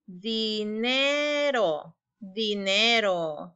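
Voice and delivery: "Dinero" drawn out very slowly, twice; slow read-along. Shown as a demonstration. Teacher reads "Dinero" together with the class, slowly. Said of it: "Vamos a leerla juntos".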